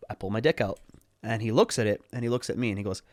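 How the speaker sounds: ordinary talk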